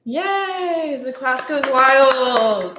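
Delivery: high excited cheer. Pretend. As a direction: celebrate like a crowd. Celebrating the student.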